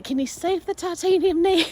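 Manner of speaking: high, loud mock-pleading question; joking plea. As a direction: retell it as a comically eager request. Joking retold request to save knee.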